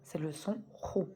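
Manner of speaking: Rou stretched slowly, throaty r; slow careful Rou; slow syllable demo. Shown as a demonstration. Teacher slowly modelling Rou for pupils. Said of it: "Avec la damma".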